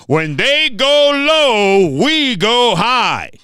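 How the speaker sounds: slow, lofty mock quote; mock Michelle Obama. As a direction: intone it slowly like a noble slogan. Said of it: "you get no Michelle Obama with me".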